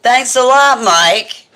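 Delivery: loud, high teasing call-out. Real, not acted. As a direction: tease him loudly and playfully. Mock scolding brother after jar.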